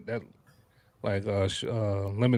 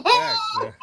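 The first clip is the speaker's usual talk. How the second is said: laughing; 3 dB louder than usual. Real, not acted. Laughing agreement.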